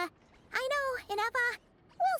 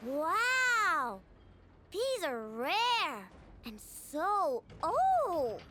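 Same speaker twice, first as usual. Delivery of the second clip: slow, drawn-out marvelling; gushing over a treasure, words stretched out; awed delight; 3 semitones lower, much slower than usual. Acted. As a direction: savour each word in wonder. Marvels at gifted rare components.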